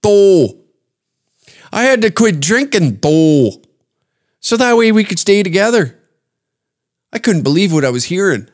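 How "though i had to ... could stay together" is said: high female impression in thick Minnesota accent. Lady explaining she quit drinking, high mock Minnesota accent. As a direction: mimic her Minnesota accent, high, stretching "though". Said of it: "She's like".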